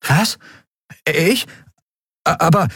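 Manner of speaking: high-pitched, stammering disbelief; startled boy. Acted. Boy is suddenly offered the helm, startled and stammering. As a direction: balk in surprise, in broken little bursts. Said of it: "Miko zuckte zusammen".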